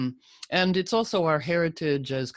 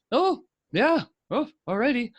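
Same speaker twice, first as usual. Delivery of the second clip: high, bright mock "oh yeah, alrighty"; mock chirpy reaction; 9 semitones higher, 4 dB louder than usual. Astrologer mocking the crowd's chirpy reaction. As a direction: chirp it like a cheery bystander.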